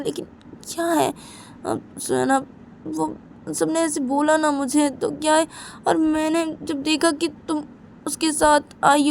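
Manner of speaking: put-on high, anxious young girl insisting; girl voice pushed high, defensive; Simran's high voice.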